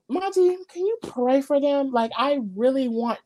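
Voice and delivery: high, soft imitation of pleading; mock pleading. Host imitating housemate asking Monty for prayers, mock pleading.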